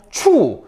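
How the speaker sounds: 추 falling sharply from high; fourth tone. Shown as a demonstration. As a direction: drop 추 fast from the top. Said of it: "사성".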